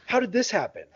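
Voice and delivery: high-pitched, incredulous protest; comically aggrieved and high; indignant disbelief. Retold or imitated. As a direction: exclaim it, mock-outraged at the unfairness. Retelling own indignant shock at booster.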